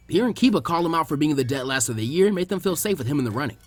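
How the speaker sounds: plain reading voice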